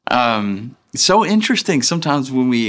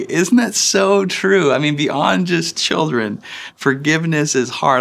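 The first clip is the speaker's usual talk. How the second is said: ordinary talk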